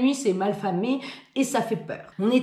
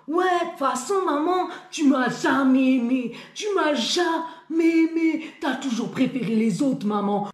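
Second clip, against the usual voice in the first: put-on drunk woman voice; 6 semitones higher than usual. Drunk woman yelling at waitress.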